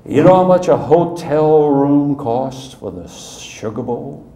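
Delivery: put-on an old coach pressing a point; hammed-up slow folksy coach; imitating Skip. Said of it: "He says".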